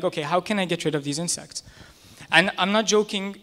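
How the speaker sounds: ordinary talk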